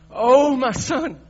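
high, loud, slow drawn-out wail of grief; grieving wail. Acted. King David mourning his son Absalom, a loud, high wail. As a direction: cry it out like a father who just lost his son. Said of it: "He's quivering and he's shaking and he's crying".

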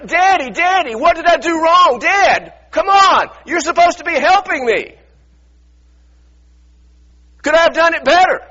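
high, loud pleading shout to a father; desperate plea. Acted. Jesus on the cross pleads with his Father, high and shouting. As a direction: beg Daddy for help, breaking open.

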